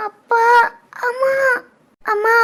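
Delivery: halting words between pangs; labour pain. Acted. Mother in labour to her unborn child, in pain. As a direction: gasp out short cries of pain.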